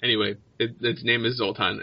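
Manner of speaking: usual speaking voice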